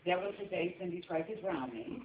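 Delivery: ordinary talk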